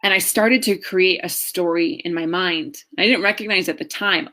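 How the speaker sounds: usual speaking voice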